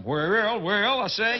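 a hint of a blustery Southern rooster; mild mock Foghorn Leghorn drawl. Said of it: "a little bit Foghorn Leghorn".

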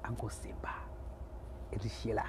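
whispered couple names. Pretend. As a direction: breathe the names secretly.

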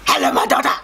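hoarse, voiceless ghostly greeting; mock ancestral spirit croak. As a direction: rasp it like a spirit in the dark. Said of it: "the second voice is like".

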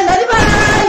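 very high excited scream; excited yell. Real, not acted. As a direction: yell it out in a sudden high-pitched burst. Yelling excitedly on stream.

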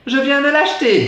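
high-pitched voice of a proud fiancée; excited high female impression; fiancée's voice. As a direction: put on a high ladylike voice. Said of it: "dit-elle".

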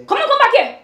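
high, loud call to come back; shouted order. Acted. House help calls the children back, shouted order. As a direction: yell after someone who is leaving.

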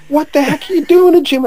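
high-pitched incredulous question; retold shocked outcry. Everybody seeing Jim dragged through thistles, high shocked outcry. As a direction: cry it out as a baffled onlooker.

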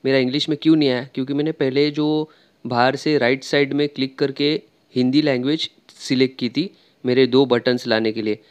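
ordinary delivery

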